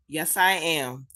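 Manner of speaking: usual speaking voice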